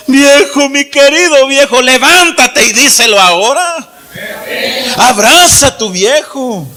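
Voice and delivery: put-on preacher urging children to embrace fathers; impassioned cry. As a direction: plead with all your heart.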